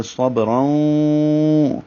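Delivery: صبرن with the ending drawn out through the nose; slow nasal recitation; nasal idgham. Shown as a demonstration. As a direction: hum the nun of صبرن slowly in the nasal bridge. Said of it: "اب ناک میں آواز جائے گی".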